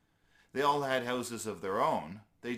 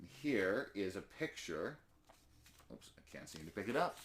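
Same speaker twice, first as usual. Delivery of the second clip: aside; 5 dB quieter than usual. Real, not acted. Narrator aside about the picture.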